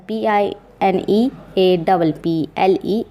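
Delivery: slow letter by letter; slow spelling. Shown as a demonstration. Teacher spells pineapple slowly for students. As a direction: dictate each letter. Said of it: "कहते हैं pineapple".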